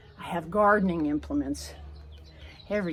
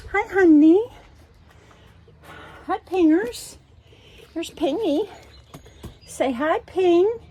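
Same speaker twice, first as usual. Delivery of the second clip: high sing-song pet talk; 11 semitones higher, 6 dB louder, slower than usual. Real, not acted. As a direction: coo at the dog. Greeting her dog.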